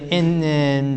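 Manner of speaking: ordinary delivery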